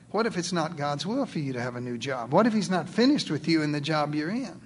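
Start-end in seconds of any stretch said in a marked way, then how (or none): none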